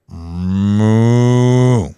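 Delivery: loud, long mooing call; toy cow moo. Retold or imitated. Teller imitating the cow can in the meeting, loud moo. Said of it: "it went".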